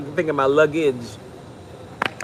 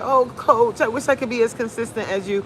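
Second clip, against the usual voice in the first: imitated admiring clients; 4 semitones higher than usual. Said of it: "everybody's like".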